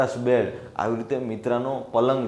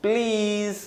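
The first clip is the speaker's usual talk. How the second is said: put-on children asking; 7 semitones higher than usual. Children asking Aunty to see the bird, high pleading voices. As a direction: plead in a high voice, stretching each word. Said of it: "એમણે કીધું કે".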